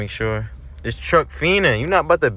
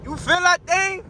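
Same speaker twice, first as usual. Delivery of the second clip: high-pitched thrilled boast, climbing as it goes; excited hype; 12 semitones higher than usual. Real, not acted. Driver boasting to twin about the pull, high excited hype. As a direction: hype it up, voice shooting way up. Hyping himself as the car accelerates.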